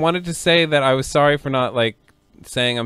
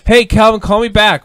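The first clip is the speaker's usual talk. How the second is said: higher, louder voicemail call; 6 semitones higher, 7 dB louder than usual. Real, not acted. Host yells a message onto Calvin's voicemail. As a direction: call out into the phone demanding.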